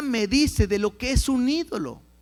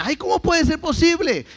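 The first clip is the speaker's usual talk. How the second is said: higher, louder scoffing "ay"; mock incredulous; 6 semitones higher, 3 dB louder than usual. Preacher imitates a mocking churchgoer, a touch incredulous. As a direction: play the laughing skeptic, lightly. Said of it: "algunos se ríen y dicen".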